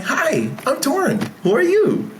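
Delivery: put-on high, eager little-kid voice; bright child asking who you are; five-year-old kid. Shown as a demonstration. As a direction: greet him like a bold five-year-old. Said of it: "Be that five-year-old and just walk up".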